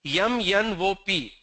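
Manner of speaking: "Yum, yan, wo" for M, N, O; South Indian letter names. Shown as a demonstration. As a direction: add glides: "Yum, yan, wo". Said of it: "Alphabet system changes in South India".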